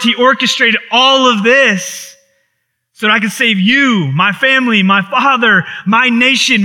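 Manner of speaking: Joseph rejoicing. Acted. Preacher voicing Joseph to his brothers, rising with excitement. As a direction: proclaim it like Joseph revealing himself.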